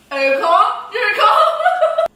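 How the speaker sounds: high, loud, laughing tease; loud teasing. Real, not acted. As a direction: squeal it mockingly. Mocking partner in pain.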